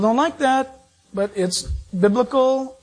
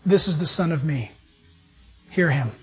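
voice from the cloud; 7 semitones lower than usual. Retold or imitated. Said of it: "a voice comes out of the cloud and says".

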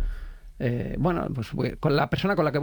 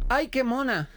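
higher-pitched imitation of a charmed date; delighted cooing date; 7 semitones higher, slower than usual. Date finds the cockroach cute, delighted high cooing. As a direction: coo over it in a higher voice. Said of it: "ella dice".

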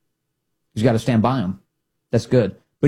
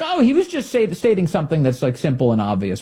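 high, mocking paraphrase of Mullin's defenders; 4 semitones higher than usual. Host mocking the defense of Mullin, high and sarcastic. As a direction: squeak it out mockingly. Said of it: "He's like".

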